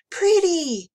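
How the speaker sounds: put-on high, loud little-girl exclamation; delighted child. A narrator's reading. Emma admiring a clawed monster, delighted child voice. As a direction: coo it brightly, pitched well up.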